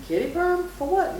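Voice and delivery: high, disbelieving question; mock-shocked mom; incredulous. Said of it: "I'm like".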